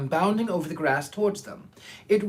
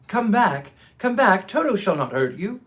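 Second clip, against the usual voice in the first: louder call to the fleeing mice; 4 semitones higher, 5 dB louder than usual. Acted. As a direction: call them back across the grass. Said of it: "he called to the mice".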